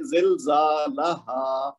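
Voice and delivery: very slow زلزالہا with la kept short; measured vowel lengths. Shown as a demonstration. Teacher shows one beat on lam, slow زلزالہا. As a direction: hold zaa and haa in زلزالہا, clip la. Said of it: "اس کو ایک حرکت دیں گے".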